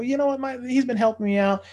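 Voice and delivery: high, sugary salesman voice; sweet talking. Retold or imitated. To the girl, setting up the boy: high sweet pitch.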